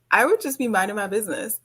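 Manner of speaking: normal talking voice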